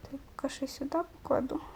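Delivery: quick whispered aside to self. Real, not acted. Gamer placing blocks, talking to himself in a fast whisper. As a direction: whisper it fast while busy.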